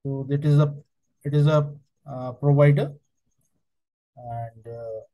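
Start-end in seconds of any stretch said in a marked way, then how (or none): none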